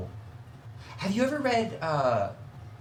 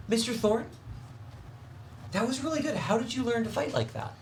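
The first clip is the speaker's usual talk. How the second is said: put-on little girl voice; 8 semitones higher than usual.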